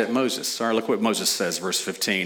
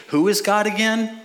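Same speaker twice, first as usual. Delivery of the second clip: much higher, airy mock question; a people who forgot God, asked in a high mock voice; mock forgetful; 9 semitones higher than usual. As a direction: play dumb, as if God slipped your mind.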